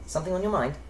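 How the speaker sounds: put-on light, airy, high English accent; lilting English elf, pitched well up; English elven voice. Demonstrating the game's English elf voice option, light airy English accent. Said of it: "pick the elven voice to be".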